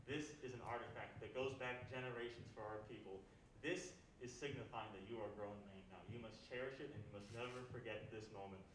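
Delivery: low, hushed ceremonial father to son; acted fatherly solemnity. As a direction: hand down an heirloom, softly and gravely. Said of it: "father and son are like".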